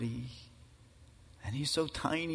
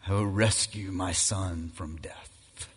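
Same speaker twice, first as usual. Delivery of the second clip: low, grave and steady; a mild hint of a commanding father; Father speaking to rescue his son, low and solemn; father's voice; 8 semitones lower than usual. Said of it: "as his father says".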